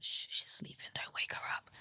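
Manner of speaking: very soft whispered imitation of friends; hushed whisper. Friends hushing each other beside a sleeper, hushed whisper. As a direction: whisper it, shushing the room.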